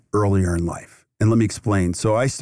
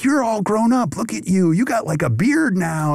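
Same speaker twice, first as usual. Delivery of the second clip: delighted greeting; 10 semitones higher than usual. Retold or imitated. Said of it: "And I'm like".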